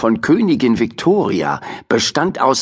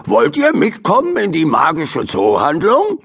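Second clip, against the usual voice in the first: 1 friendly invitation; 6 semitones higher than usual. Acted. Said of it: "rief Mister Morrison".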